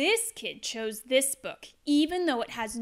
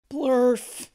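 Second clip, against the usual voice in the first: silly nonsense sound; 6 dB louder than usual. Acted. Reading a silly nonsense word.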